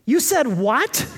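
slow, indignant retort, hammed up; mock-calm outburst. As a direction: play an offended husband's slow challenge. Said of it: "So very calmly I said".

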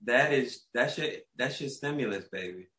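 soft, slow, gentle explaining; sweet, patient clerk. Retold or imitated. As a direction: break it to them slowly and softly. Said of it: "she's so sweet. More patient".

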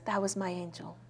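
very soft, retold with quiet wonder; mother's cherished words, hushed; hushed reverence. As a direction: say it almost under the breath.

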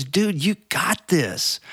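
high, slow pep-talk; encouraging dude. Retold or imitated. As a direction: pump him up, one word at a time. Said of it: "the guy's tone and his voice just lit up".

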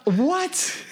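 high, laughing incredulous question; laughing disbelief. Retold or imitated. Counselors laughing in disbelief at the drunk kid. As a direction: laugh through the disbelief, pitch climbing on "what". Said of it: "We're like".

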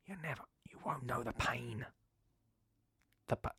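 hushed, melodramatic whisper; a tragic stage whisper to parents; mock whisper. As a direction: confide the parents' secret pain. Parents' holiday pain, mock tragic.

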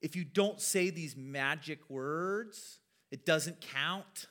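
slow, deliberate "If you don't say these magic words"; slow mock insistence. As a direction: spell it out like a strict rule. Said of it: "as far as saying".

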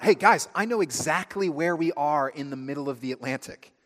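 excited friend shouting the facts; eager blurt. Acted. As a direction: blurt it out like the class show-off. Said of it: "And they blurt out".

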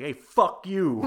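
hammed-up New York tough guy telling off a clerk; mock snarl. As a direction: snap it back like a jaded New Yorker. Tough New Yorker snapping at clerk.

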